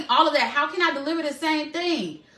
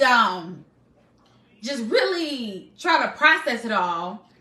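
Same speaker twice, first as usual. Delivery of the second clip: ordinary delivery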